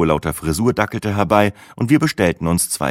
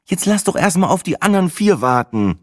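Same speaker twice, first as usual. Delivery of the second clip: higher, slightly irritated; a bit annoyed; 12 semitones higher than usual. A narrator's reading. Sina fending off the question, a little annoyed. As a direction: brush it off, mildly impatient. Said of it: "ein klein wenig genervt".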